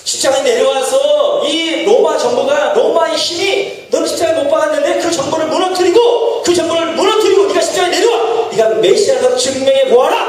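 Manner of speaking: mocking shout of the crowd daring him; jeering taunt. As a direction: jeer at him as the crowd would. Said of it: "라고 이야기하는 것입니다".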